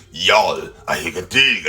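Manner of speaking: mock child in Klingon; kid speaking Klingon. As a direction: growl it like a little Klingon. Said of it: "looks at his parents and says".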